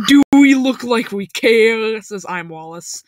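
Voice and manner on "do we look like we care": defiant retort. Acted. Acted defiant retort to attendant.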